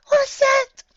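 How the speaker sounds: high startled cry; cried out. Acted. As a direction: cry it out in alarm. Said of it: "she cried out".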